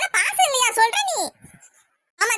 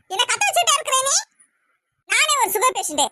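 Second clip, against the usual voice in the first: scolding; 5 semitones higher than usual. Acted. Scolding dead husband's photo.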